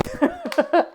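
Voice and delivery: high-pitched burst of laughter; high squealing laugh. Real, not acted. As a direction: giggle up high at yourself. Laughs at her own voice.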